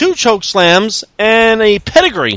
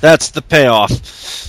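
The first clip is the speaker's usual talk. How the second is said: slow deadpan; 4 semitones lower, much slower than usual. Real, not acted.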